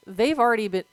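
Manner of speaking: ordinary delivery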